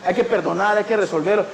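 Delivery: ordinary talk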